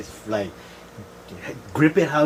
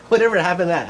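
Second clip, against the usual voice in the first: fast, laughing question; 6 semitones higher, 5 dB louder, much faster than usual. Real, not acted. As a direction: giggle through it.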